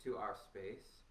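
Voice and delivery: soft, unhurried meditation guide; guided meditation. Acted. Teacher guiding students through a body scan, soft and calm. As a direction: ease the class gently into their bodies.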